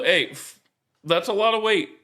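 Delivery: ordinary delivery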